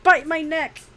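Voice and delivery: hammed-up cry to a partner; mock demand.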